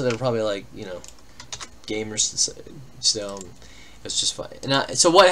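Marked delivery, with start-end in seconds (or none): none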